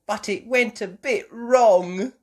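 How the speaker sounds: put-on high, slow sheepish girl; sheepish Peppa. A narrator's reading. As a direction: confess it sweetly. Peppa admits mess.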